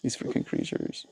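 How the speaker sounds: a quiet complaint not meant to carry; whispered gripe. Real, not acted. Streamer whispering a gripe about noisy creatures.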